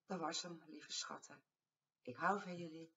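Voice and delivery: usual speaking voice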